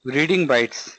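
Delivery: slow, word-by-word dictation pace. Acted. Teacher dictating a definition to students, slow and measured. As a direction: spell it out slowly so students can write it down.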